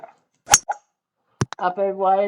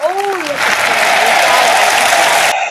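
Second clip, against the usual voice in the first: loud, drawn-out cry of delight; thrilled shout at a near hole-in-one; excited shout; 10 semitones higher, 11 dB louder, much slower than usual. Real, not acted. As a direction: yell it out as the ball strikes the pin. Big moment on the golf green.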